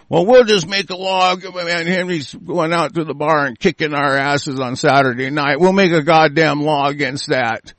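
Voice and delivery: lightly voiced small-time schemers, toned down; mock lawmakers' plotting. Punks agreeing on a law over a bar fight, mock plotting.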